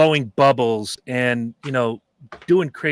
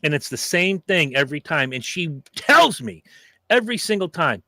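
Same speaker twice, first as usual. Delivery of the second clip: usual speaking voice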